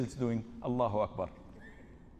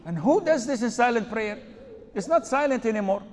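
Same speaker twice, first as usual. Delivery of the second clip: higher and louder, incredulous; exasperated outcry; 8 semitones higher, 8 dB louder than usual. Real, not acted. The sheikh mocks the worried man's silent prayer, loud and exasperated. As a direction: throw up your hands at the absurdity.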